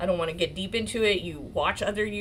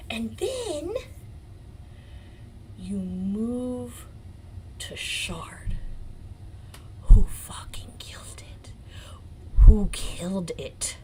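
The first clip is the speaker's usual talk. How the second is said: dramatic whisper; slower than usual. Real, not acted. To viewers hyping the lawyer Shard, stage whisper. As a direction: whisper it like a big reveal.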